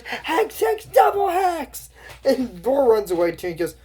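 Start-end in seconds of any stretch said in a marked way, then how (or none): 0.0-1.9 very high, drawn-out incantation shout; hammed-up witch casting spells. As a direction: hurl the hex in a high shriek. Acting spell being cast.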